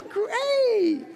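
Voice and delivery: high, mock-cheery "yay, great"; sarcastic yay. Preacher mocking congregation's fake cheer, high sarcastic yay. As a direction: fake cheer that means the opposite. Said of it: "So you can go".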